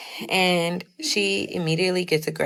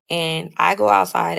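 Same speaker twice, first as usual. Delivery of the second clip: ordinary delivery, nothing marked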